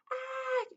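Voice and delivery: high yelp of a troll tossed in the air; troll crying out as he is flung up; troll's shout. A narrator's reading. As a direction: let out a sudden high cry. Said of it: "shouted the troll".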